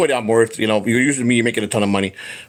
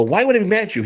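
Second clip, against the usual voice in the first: pitched-up, puzzled question; surprised reassurance; 8 semitones higher, faster than usual. Retold or imitated. Telling Shuli why he isn't upset, in high surprise. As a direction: brush off the idea he'd be mad. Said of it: "I go".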